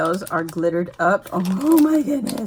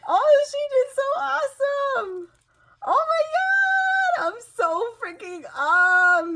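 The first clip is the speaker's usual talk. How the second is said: overjoyed squeal. Real, not acted. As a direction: gush in a high squeal, overwhelmed with joy. Overjoyed by the card, gets goosebumps.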